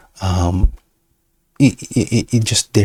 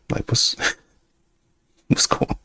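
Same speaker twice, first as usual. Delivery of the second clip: whisper; 5 dB quieter than usual. Retold or imitated.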